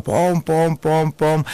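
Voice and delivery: high, playful pom pom sound effect; mock rhythmic pom. Narrator mimicking the doctor's lovemaking, playful high pom pom. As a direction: tap out a cheeky high rhythm.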